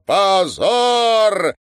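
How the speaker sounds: high, loud, long cry; long bellow of condemnation; drawn-out full-strength shout. A narrator's reading. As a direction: shout with all your might, stretching it. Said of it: "протяжно, что есть мочи, прокричал Смирнов".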